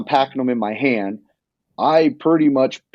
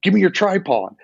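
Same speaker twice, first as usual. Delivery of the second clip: retold yell; 7 semitones higher than usual. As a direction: call up the hill to a buddy, pitched up. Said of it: "and I yell at Todd".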